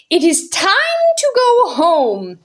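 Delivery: trumpeting call. Acted. Mary Poppins calling over the laughter, loud and firm. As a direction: cut through the laughter like a trumpet. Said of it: "sounded above the roars ... like a trumpet".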